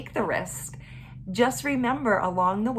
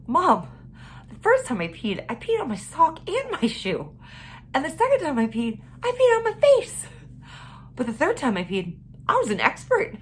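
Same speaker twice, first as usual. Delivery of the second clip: put-on proud little girl voice; 7 semitones higher than usual. Mother voices proud daughter.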